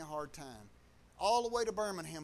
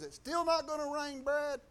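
higher-pitched needling jab; retold teasing; 7 semitones higher, 3 dB louder than usual. Driver looks at Brad in the downpour, higher-pitched mock needling. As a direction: imitate a doubter ribbing him, pitched up.

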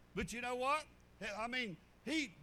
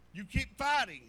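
rising exhortation; 8 dB louder than usual. Acted. Preacher exhorting.

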